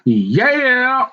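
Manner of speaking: high, drawn-out yeah; exclaimed yeah. Acted. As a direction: let the yeah leap up and hold. Exclaims after a low oh yeah.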